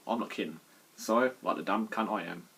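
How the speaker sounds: ordinary delivery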